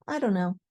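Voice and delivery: I don't know squished to I dunno; casual dunno. Shown as a demonstration. Friend answers the dinner invite with a relaxed dunno. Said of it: "a conversation in English can go like this".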